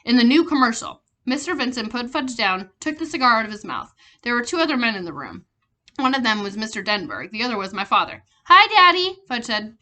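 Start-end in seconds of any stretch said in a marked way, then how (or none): 0.0-1.0 loud, proud announcement; announcing. A narrator's reading. President announcing Fudge to the men. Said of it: "He announced". 8.5-9.2 put-on toddler greeting.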